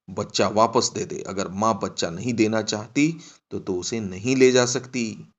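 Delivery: loud, resolute constable giving an order; firm authority. Acted. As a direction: lay down the law firmly and loudly. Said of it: "हवलदार की आवाज में दृढ़ता थी".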